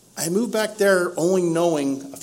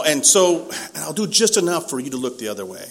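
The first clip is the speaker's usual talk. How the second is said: ordinary delivery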